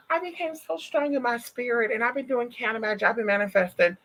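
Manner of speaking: a light imitation of the boastful lady; mocking the lady. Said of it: "So, girl, that was her".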